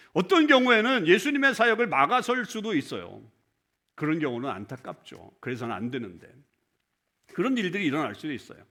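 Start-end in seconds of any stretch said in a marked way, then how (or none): none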